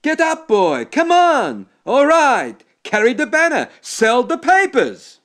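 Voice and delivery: high, loud, rallying shout; rousing holler. A narrator's reading. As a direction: rouse the whole room like a foreman. Klopman hollering wake-up call.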